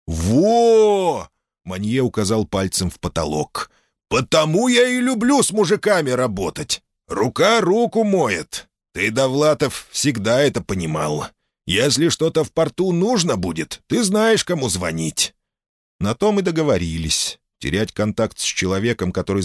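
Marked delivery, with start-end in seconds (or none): 0.1-1.3 loud high "Во!"; triumphant exclamation. Acted. Manye exclaims, pointing up. 4.1-8.7 high jovial dock boss; hearty port boss. Acted. Manye pleased. 8.9-9.9 jovial boss naming him; hearty port boss. Acted. Name repeated. 9.9-15.3 high jovial dock boss; hearty port boss. Acted. Manye pleased.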